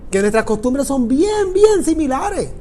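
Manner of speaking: ordinary delivery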